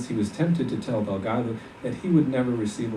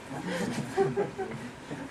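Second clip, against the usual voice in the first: breathy laugh; 7 dB quieter than usual. Acted. Delgado laughs at bitter memory.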